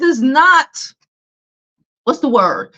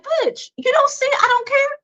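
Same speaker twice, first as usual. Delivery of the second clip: a mocking high-pitched imitation of a pushy fan; 13 semitones higher than usual. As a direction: sneer it out like an annoying fan. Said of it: "and they still like".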